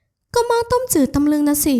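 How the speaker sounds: high, slow, testy retort; testy woman character. A narrator's reading. Woman answers back in dialogue. (ตอบเสียงสูงอย่างรำคาญ)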